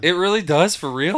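loud, high-pitched disbelief; real astonishment. Real, not acted. Co-host learning people say lol out loud, loudly incredulous. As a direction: blurt it out, stunned.

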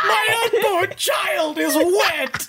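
mock weeping. Player lamenting wet family, hammed-up sobbing. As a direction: overplay the grief. Melodramatic crying bit.

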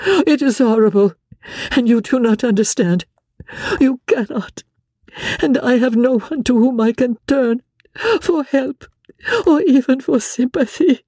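a distressed wife begging her husband; Marguerite pleading. A narrator's reading.

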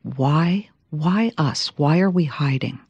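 usual speaking voice

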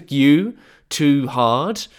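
loud, drawn-out mock disbelief; mock scoffing. Phil laughing at Pete's claim, retold as a slow scoff. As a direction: scoff at the very idea, stretching it out. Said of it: "Phil, like, scoffs at him".

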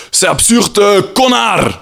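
high, loud angry yell; loud shout. Retold or imitated. Mate shouting at the harasser, loud angry yell. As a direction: hurl the insult across the tram. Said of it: "roept hij, heel luid".

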